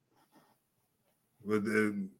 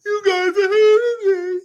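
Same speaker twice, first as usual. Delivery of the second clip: squeaky, laughing mock complaint; mocking high voice; 6 dB louder than usual. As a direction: whine it high, half laughing. Retold quote, mocking crybaby.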